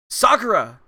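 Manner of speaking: loud, high cry of a name; alarmed call. Acted. Calling out to fallen friend.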